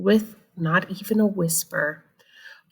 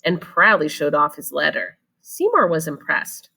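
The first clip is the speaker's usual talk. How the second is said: ordinary delivery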